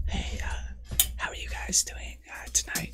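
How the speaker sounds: hushed whisper into the mic; imitated ASMR whisper. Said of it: "then whisper in the mic".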